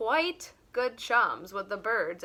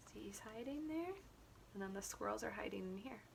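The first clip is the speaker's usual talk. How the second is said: quick, much softer aside to the listener; hushed aside; 2 semitones lower, 20 dB quieter, much faster than usual. Real, not acted. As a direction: drop out of the story and point at the page softly. Aside pointing at the picture.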